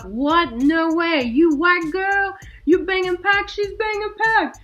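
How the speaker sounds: high, loud stunned exclamations; slow down on the disbelief; amazed disbelief. Retold or imitated.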